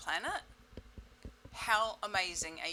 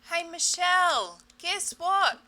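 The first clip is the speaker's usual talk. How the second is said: higher, louder, slower call; 10 semitones higher, 11 dB louder, slower than usual. Pretend. Her body calling Michelle louder to be noticed. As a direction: raise it to get attention.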